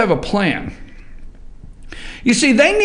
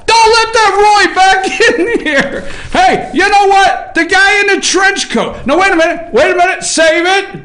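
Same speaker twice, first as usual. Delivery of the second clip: mock shouting; 8 semitones higher than usual. Real, not acted. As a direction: yell up at the crew, playfully frantic. Host yelling at control booth.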